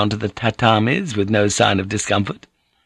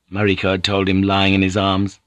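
ordinary delivery, nothing marked

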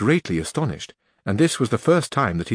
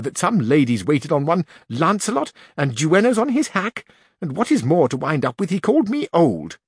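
put-on high aggrieved old woman's voice; offended old duenna; 7 semitones higher than usual. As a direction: complain to the mistress in outrage. Duenna complaining.

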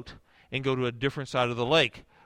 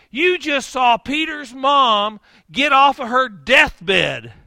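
slow, exasperated rebuke building louder; exasperated scolding; 6 semitones higher, 4 dB louder, slower than usual. Retold or imitated. Jesus scolds his frightened disciples, exasperated. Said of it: "I think that's what Jesus was thinking".

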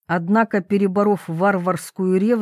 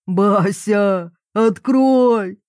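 drawn-out bellowed demand; yelling; 4 dB louder, much slower than usual. A narrator's reading. Papa shakes the others off at the tent, yelling. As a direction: bellow to be let in. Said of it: "заорал".

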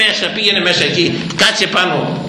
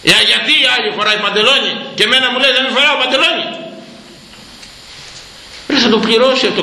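higher-pitched mock jealous complaint; imitating complaining woman; 6 semitones higher than usual. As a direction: mimic an envious penitent protesting. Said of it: "Μου λέγε κάποια". (ψιλή μίμηση παραπονιάρας)

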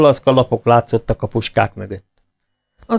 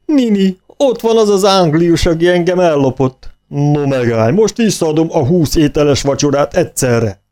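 roaring; 6 semitones higher than usual. A narrator's reading. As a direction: roar at his enemy. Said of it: "felordított a ténsúr".

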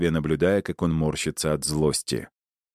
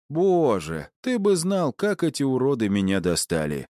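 lightly voiced thug; 8 semitones higher than usual. A narrator's reading. Boltun complaining.

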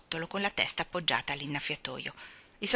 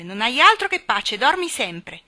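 louder, cutting reply; cruel retort; 5 semitones higher, 9 dB louder than usual. Acted. Jane mocking the sleepy gardener, cruel. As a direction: throw it back at him cruelly. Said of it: "rispose Jane crudele".

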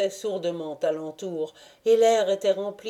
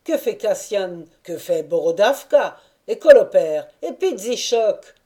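louder, overlapping questions; eager questions; 7 dB louder than usual. A narrator's reading. Cossacks asking after their friends, louder. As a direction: fire questions at old comrades. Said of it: "ces questions confuses".

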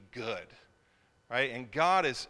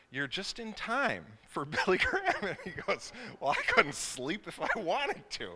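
higher-pitched, laughing through the quote; 6 semitones higher than usual. Real, not acted. Retelling parents' late-night exchange to congregation, laughing. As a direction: tell it through real laughter.

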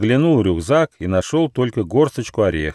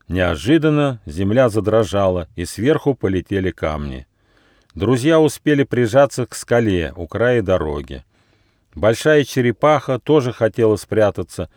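plain reading voice

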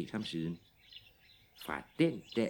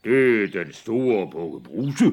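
loud gruff goat voice; gruff big goat; 10 dB louder than usual. Acted. Big goat answers the troll, loud and gruff. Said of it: "Han var lidt grov i mælet".

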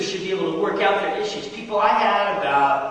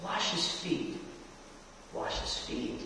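hushed, soft, much lower voice of Jesus; 4 semitones lower, 16 dB quieter, slower than usual. Retold or imitated. Preacher voicing Jesus to the congregation, hushed and low. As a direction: breathe it softly as the Lord's command. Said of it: "I could just hear Jesus say".

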